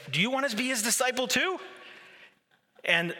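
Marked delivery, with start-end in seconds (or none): 0.1-1.6 higher, fast mock retort; sarcastic jab. Healed man mocking the Pharisees, higher and fast. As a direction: needle them with sarcasm.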